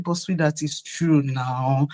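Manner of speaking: low, soft aside to a husband; hushed, coaxing retold reply; subdued placating. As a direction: quietly plead your case, slowing down.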